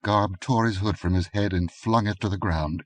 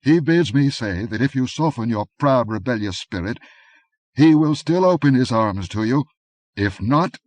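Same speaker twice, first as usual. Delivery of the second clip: higher, louder courtly menace; cold envoy; 5 semitones higher, 5 dB louder than usual. A narrator's reading. Masked envoy to the Countess, higher and louder menace. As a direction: offer mercy with a threat beneath. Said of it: "said the tallest of the three".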